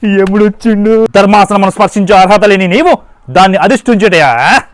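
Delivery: loud, grand mythological god of death; thundering Yama. Acted. Yama rebukes the human on his throne, loud booming declaration. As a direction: boom it like a stage-drama Yama rebuking a mortal.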